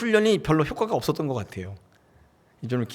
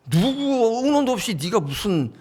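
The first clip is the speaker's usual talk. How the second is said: higher, slow put-on whine; whiny indignant disciple; 7 semitones higher than usual. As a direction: drag out the complaint like a sulking rival. Jealous disciple complains, put-on voice.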